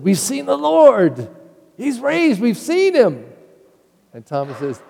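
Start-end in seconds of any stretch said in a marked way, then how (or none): 0.0-3.3 much higher, slow and joyful; bursting with the news; thrilled. Acted. Apostles telling Thomas they saw the Lord, thrilled. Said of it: "the apostles were all thrilled".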